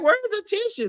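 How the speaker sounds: frantic question. Retold or imitated. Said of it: "Karen was like".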